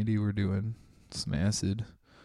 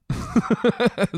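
a loud, high laugh bursting out; cracking up at his own story; high burst of laughter; 14 semitones higher, 6 dB louder than usual. Real, not acted. Telling a friend a strange story, laughing loudly.

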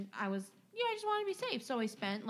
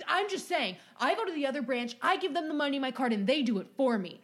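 louder, fast indignant customer rant; mock angry rant; 4 semitones higher, 5 dB louder, faster than usual. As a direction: rattle off the grievance like an entitled customer. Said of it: "He was like".

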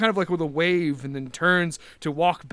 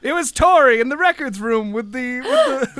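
higher, louder, laughing accusation; mock dramatic Clue reveal; game-show accuser; 7 semitones higher, 7 dB louder than usual.

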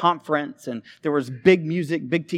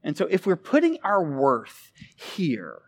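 ordinary talk, nothing marked

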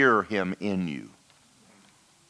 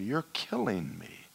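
hushed broken plea; 8 dB quieter, slower than usual. Retold or imitated. Falls on face, repeats plea.

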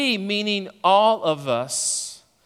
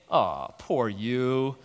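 mock pity; 5 semitones lower, 5 dB quieter, much slower than usual. Pitying quote from acquaintances.

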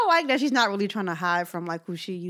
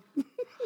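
short high laugh; 6 dB quieter than usual. Real, not acted. Laughs at her own impression.

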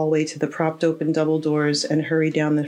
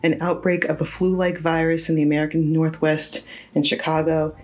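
usual speaking voice

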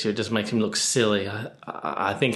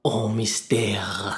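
slow whispered French echo; drawn-out, breathy mock-French; French accent; 3 dB quieter, much slower than usual. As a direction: savour the French name. Echoes French name theatrically.

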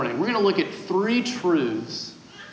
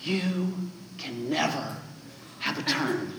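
whispering; 2 semitones lower, 9 dB quieter than usual. Retold or imitated. Big sister taunts little sister, a soft spiteful whisper. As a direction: breathe it spitefully into her ear. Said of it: "she whispers right in her ear".

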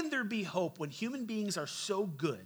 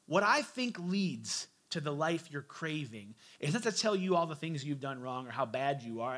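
ordinary talk, nothing marked

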